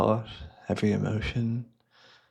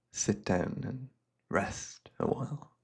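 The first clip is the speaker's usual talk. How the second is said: whisper to rest; 11 dB quieter than usual. Acted. Urging weary guest to rest.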